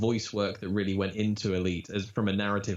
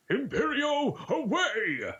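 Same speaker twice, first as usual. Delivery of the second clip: high crab battle cry; grand, high-pitched exit line; tiny crab hero charging off; heroic crab; much slower than usual. Acted. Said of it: "he just goes".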